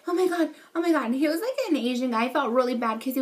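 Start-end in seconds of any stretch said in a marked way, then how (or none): 0.1-1.1 panicked. Retold or imitated. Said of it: "he's screaming".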